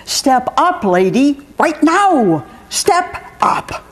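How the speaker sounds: high, exclamatory mock order; emphatic command. Maureen's morning routine order to the bird, exclamatory. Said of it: "right down to the final exclamation point".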